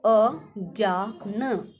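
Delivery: अज्ञान stretched very slowly and clearly; slow correct form. Shown as a demonstration. Teacher giving the correct spelling, very slow अज्ञान. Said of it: "अज्ञान का शुद्ध रूप".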